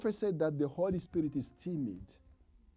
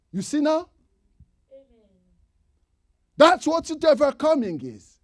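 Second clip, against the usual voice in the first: higher, louder preacher's cry; preaching loud; 6 semitones higher, 13 dB louder than usual. Acted. Preacher crying out to the church. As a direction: drive it home to the congregation.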